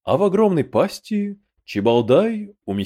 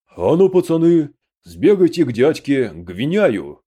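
louder, higher call to the lads; 3 semitones higher, 6 dB louder than usual. A narrator's reading. Village elder to the boys, sending them on an errand, calling out. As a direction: send the boys running with a raised voice.

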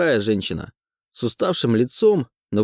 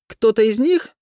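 shrill woman voice; 12 semitones higher, 4 dB louder than usual. A narrator's reading. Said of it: "визгливый голос".

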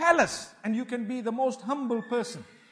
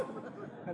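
soft laugh; 16 dB quieter than usual. Real, not acted. Laughing at own joke.

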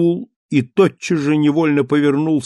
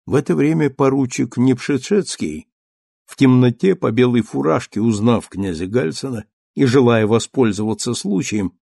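plain reading voice

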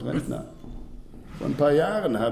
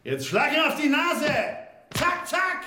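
calling out orders. Acted. Director calling out on film set.

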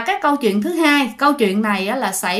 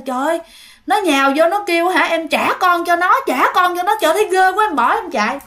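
frightened telling; 6 semitones higher than usual. Acted. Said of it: "cô này mới nói".

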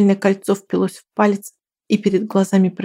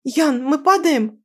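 panicked cry, higher and slow; terrified, drawn-out plea; 6 semitones higher, slower than usual. Acted. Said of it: "в панике уставилась".

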